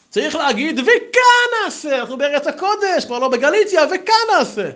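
high, loud mock proclamation; hammed-up indignant cry; acted emphatic ruling. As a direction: proclaim it like an outraged rabbi, stretching וכאן. Retold rabbi's emphatic ruling.

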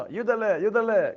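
loud, slow sing-song taunt of the name; drawn-out mocking call. Friends taunting Yudale over the fence, slow loud call.

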